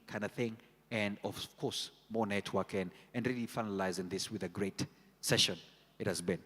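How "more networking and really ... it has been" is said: ordinary talk, nothing marked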